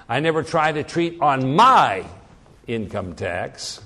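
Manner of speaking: emphatic, lengthened "my"; stress on MY. Shown as a demonstration. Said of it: "never do it on my income tax".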